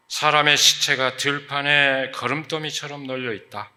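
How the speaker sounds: loud, forceful declaimed scripture reading; scripture proclamation. Acted. Preacher reading God's word to the congregation, loud proclamation. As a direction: proclaim it as the Lord's own message.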